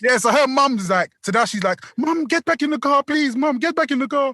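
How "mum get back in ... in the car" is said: high, urgent mimicked plea; higher put-on imploring voice; daughter pleading. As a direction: imitate a daughter begging her mum. Voices daughter pleading with her mum.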